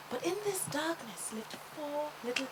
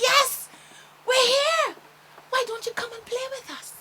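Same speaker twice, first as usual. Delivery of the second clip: excited; 10 semitones higher, 8 dB louder than usual. Acted. Two children answering eagerly.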